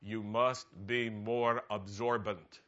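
put-on slow, careful Israeli-accented English; a lightly put-on Israeli accent, word by word; Israeli officer's English. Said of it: "in his best English".

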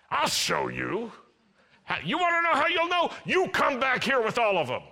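high, loud, fast New York hustler; New York accent. Acted. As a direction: pitch it like a New York garment dealer. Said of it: "New York City garment district entrepreneur".

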